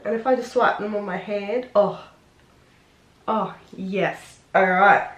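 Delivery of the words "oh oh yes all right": ordinary talk, nothing marked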